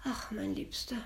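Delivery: soft sighing wife. Acted. Wife sighing to her husband, softly. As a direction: sigh it out to him. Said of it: "seufzte seine Frau".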